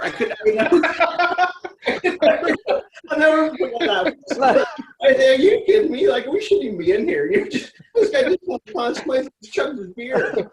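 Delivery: real laughter breaking through high-pitched words; laughing. Real, not acted. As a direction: crack up while telling it. Laughing through the beer story.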